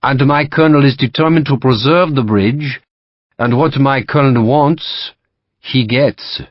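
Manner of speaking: put-on courteous French-accented captain; French officer. French captain to Sharp, polite French accent. Lacroix voiced.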